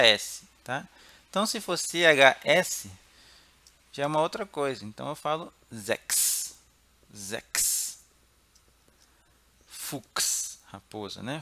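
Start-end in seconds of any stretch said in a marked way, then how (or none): 5.7-10.6 "Zex" with a hard k and long hissed s; CHS as KS. Shown as a demonstration. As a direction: end "Fuchs" in a crisp "ks". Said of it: "o C H com som de K". ("Fuchs" com som de K mais S)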